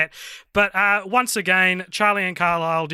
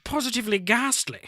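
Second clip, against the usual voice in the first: higher, plummy posh English voice; posh British accent; 7 semitones higher, 4 dB quieter than usual. Shown as a demonstration. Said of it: "I wanna hear you go posh".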